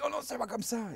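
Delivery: high, indignant exclamation; protesting quote. Retold or imitated. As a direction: squeal out the protest. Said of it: "Et puis ils disent".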